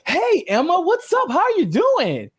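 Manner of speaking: high, bright overfriendly greeting; mock sing-song hello; imitating Pasta. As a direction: greet her with fake cheer. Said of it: "he goes".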